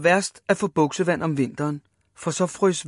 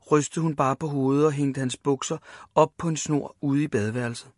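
plain reading voice

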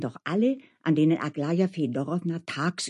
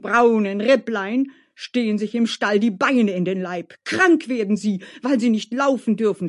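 higher, louder, impassioned lament; indignant coachman Dmitri; 5 semitones higher, 6 dB louder than usual. Acted. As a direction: rant with wounded pride, building to a gallop. (aufgebrachter Kutscher, leidenschaftlich klagend)

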